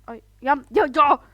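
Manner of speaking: loud rising frustrated yell; frustrated cry. Real, not acted. Dying in the same spot, a frustrated yell.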